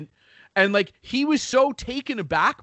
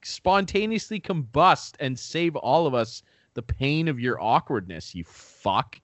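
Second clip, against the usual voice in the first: usual speaking voice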